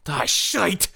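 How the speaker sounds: high-pitched dwarf exclamation; dwarf's exclaimed oath; Krieg the dwarf. Acted. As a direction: curse in a put-on dwarf voice. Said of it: "Krieg's like".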